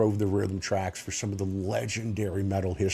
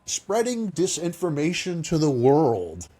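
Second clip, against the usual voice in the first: mocking quote; 6 semitones higher than usual. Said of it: "and I quote".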